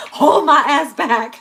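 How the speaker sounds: slow, loud, cracking up; laughing through it. Real, not acted. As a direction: choke it out between laughs. Laughing through acted plea.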